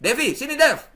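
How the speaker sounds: loud, high-pitched call; brusque summons. Shown as a demonstration. Lecturer summons student Devi, brusque loud call. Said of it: "Saya misalnya dosennya Mbak Devi".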